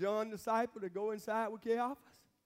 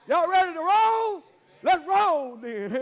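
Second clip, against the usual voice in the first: rallying shout; 7 semitones higher, 10 dB louder, slower than usual. Real, not acted. Preacher rallying congregation, shouted.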